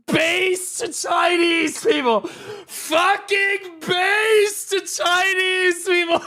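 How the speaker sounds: drawn-out, loud hype shout; slow hype shout. Real, not acted. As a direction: yell each word slowly and loud. Hyped shouting at lucky pull.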